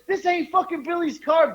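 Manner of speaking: high-pitched mock scolding; retold angry retort. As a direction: act out an irate driver.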